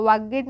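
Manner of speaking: वागेड in two syllables, "vaa-ged"; wrong pronunciation. Shown as a demonstration. Teacher repeats what not to say, mispronounced as spelt. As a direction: give वागेड a full "-ed" ending. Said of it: "वागेड नहीं".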